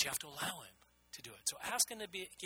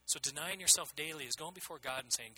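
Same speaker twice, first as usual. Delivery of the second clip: usual speaking voice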